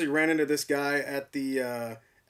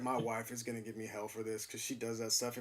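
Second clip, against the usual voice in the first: hushed worry; 5 semitones lower, 13 dB quieter than usual. Retold or imitated. Embarrassed thought retold.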